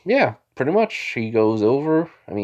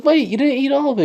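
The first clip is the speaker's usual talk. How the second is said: high, louder mock-mom protest; 5 dB louder than usual. Mom stops her son leaving dinner, protesting. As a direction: call him back in a higher voice.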